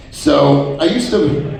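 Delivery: ordinary talk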